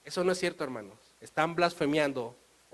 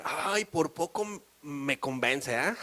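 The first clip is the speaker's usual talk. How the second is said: slow, sly mock of a doubter; imitating a listener who is almost convinced; mocking skeptic; slower than usual. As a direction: tease the half-convinced listener. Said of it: "muchos que dirán".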